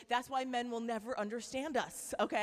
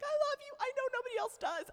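much higher squeaky cat talking; 13 semitones higher, 7 dB louder, faster than usual. Pretend. The cat lady's cats coo at her in squeaky voices. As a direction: voice a clingy cat. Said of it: "being like".

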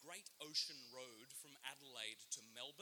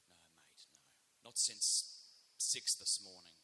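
low, hushed "no", then a louder slow kicker; deadpan barman; 9 semitones lower, 8 dB louder, slower than usual. Retold or imitated. Barman answering a tourist about shark sightings, deadpan and low. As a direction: play it dead serious, deep in pitch. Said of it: "And he wasn't joking".

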